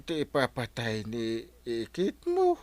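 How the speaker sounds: tearful, choking on the words; weeping with remorse; crying. Acted. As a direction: cry out of guilt. Guilt over uncle's death.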